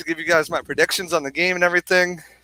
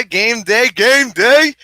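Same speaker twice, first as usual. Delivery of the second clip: rising, punchy hype chant; higher and louder on the repeat; game-day hype; 5 semitones higher, 6 dB louder, much slower than usual. Real, not acted. Co-host rallying viewers on game day, excited hype.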